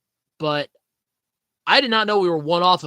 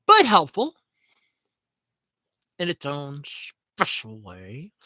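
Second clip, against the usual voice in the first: sarcastic; 4 dB louder, slower than usual. Real, not acted. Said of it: "sardonic tag about ChatGPT".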